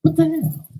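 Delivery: a sudden high cry of what the hell; outraged disbelief. Real, not acted. Ranting to viewers about a six-hundred-dollar bill, outraged disbelief. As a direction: throw the words up high in shock.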